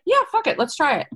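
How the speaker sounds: high, gung-ho burst on Yeah; carefree actor. Retold or imitated. Imitating a game actor who agrees, high and gung-ho. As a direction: toss it off like a fearless actor. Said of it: "don't you love the actor that's like".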